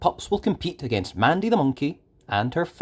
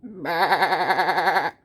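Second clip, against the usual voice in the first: higher, drawn-out bleating baa; sheep bleat; 5 semitones higher than usual. A narrator's reading. A sheep chips in to Farmer Al with a bleat. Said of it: "one of his sheep chips in".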